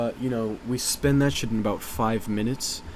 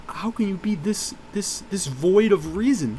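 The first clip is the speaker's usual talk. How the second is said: normal talking voice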